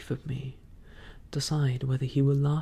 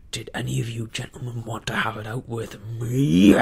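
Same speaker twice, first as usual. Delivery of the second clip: pirate bellowing a challenge, much louder; roaring challenge; 9 dB louder than usual. Acted. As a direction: roar it at the whole crew. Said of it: "roared Silver".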